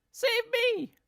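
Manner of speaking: very high, desperate screamed plea; screaming plea. Acted. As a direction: shriek it as you shoot skyward. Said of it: "she screamed".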